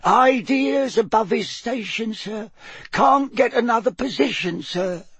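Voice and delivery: high little voice, dropping on each title; sad elf squeak. A narrator's reading. House elf tells Harry of her friend's troubles, sadly squeaking. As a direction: squeak it mournfully. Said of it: "said Winky sadly".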